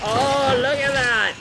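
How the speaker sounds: excited exclaim. Acted. Spots trap triumphantly.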